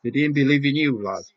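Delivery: loud emotional cry. Real, not acted. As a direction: shout it in triumph for him.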